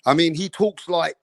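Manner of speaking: ordinary talk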